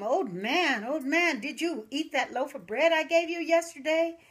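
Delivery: high, loud desperate calling out; frantic call. Acted. Mother running down the road calling the old man, frantic. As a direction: cry out to him in panic. She runs out calling to him.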